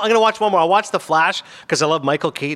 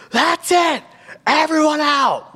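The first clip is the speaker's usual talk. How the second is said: high, slow bellowing yell of an angry man; retold scream; 13 semitones higher, much slower than usual. Adult man ordering noisy kids out, high yelling. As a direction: throw everyone out at the top of your lungs. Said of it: "scream top of his lungs".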